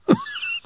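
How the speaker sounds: short high bitter laugh out of tears; a laugh that is half a sob. A narrator's reading. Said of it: "weeping turned to bitter laughter".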